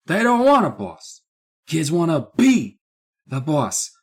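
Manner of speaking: lightly hammed-up storybook reading; mock author voice. Podcast host reading the author's note aloud, hammed up. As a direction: read the author's note like a pompous grandpa. Reads book introduction in put-on voice.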